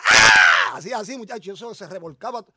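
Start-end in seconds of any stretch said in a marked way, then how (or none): 0.0-0.8 low, loud mock yell of an anointed man; a short imitated shout, loud and low; retold shout. Preacher imitating the anointed man's shout. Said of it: "entonces él gritaba".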